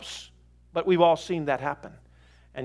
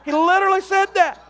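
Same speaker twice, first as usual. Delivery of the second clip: high, loud laugh through the words; laughing disbelief; 7 dB louder than usual. Real, not acted. Pastor laughing in disbelief to the congregation. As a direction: laugh it out, amazed.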